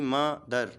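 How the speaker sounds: "मदर" in two separated beats; syllable by syllable. Shown as a demonstration. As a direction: break "मदर" after its first syllable. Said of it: "ऐसे ही". ("मदर" को दो हिस्सों में तोड़कर)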